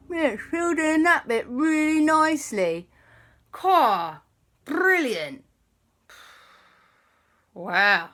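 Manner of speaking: chuffed uncle. Acted. He was super chuffed.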